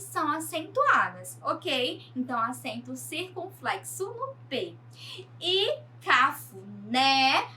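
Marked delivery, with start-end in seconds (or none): 5.4-7.5 cafuné said very slowly, "né" leaned on; teacher showing the final stress. Shown as a demonstration. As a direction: stretch cafuné out and land on "né". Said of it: "lá no né".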